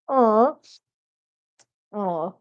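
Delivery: ordinary talk, nothing marked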